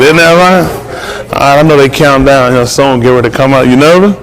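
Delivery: ordinary delivery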